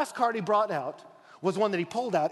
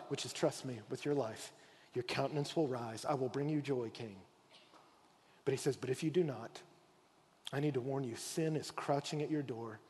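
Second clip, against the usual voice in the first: gentle warning; 6 semitones lower, 8 dB quieter than usual. Acted. God gently warning Cain.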